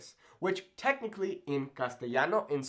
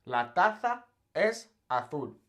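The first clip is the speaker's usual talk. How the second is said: Castilian Spanish: "La taza es azul" slowly, Z as TH; "taza" as tatha, "azul" as athul; Castilian Spanish lisp. Shown as a demonstration. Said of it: "much bigger difference".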